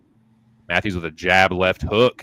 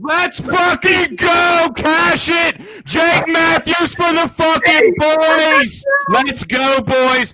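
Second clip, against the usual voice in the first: hyped victory shout, much higher and louder; frat bro; 5 dB louder, much slower than usual. Real, not acted. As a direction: yell it like your bet just cashed. Celebrating a winning bet.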